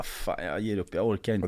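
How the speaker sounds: low, soft giving up; resigned. Retold or imitated. Driver giving up on drunk friend, low resigned voice. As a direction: drop the voice in mock defeat.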